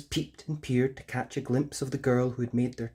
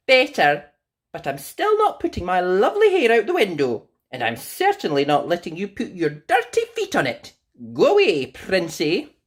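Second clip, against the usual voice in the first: Rapunzel dismissing; 7 semitones higher, 8 dB louder than usual. Acted.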